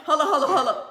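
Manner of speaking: mock panic, laughing; panicked imitation. Marcus trying to escape the question, laughing panic. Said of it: "Marcus was like".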